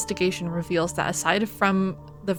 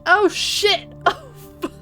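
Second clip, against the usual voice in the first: startled; 13 semitones higher, slower than usual. Real, not acted. Stream frames dropped suddenly.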